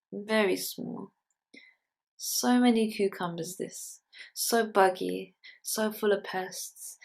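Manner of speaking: poem reading. Acted. Poet reading a pickling poem to an audience, measured recital. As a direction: read it out in deliberate phrases with pauses.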